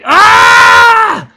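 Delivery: high, loud shriek of disgust, slowing down; a loud imitated outburst at a dirty house; disgusted yell. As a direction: scream it like the smell hit you. Retelling a friend's disgusted outburst.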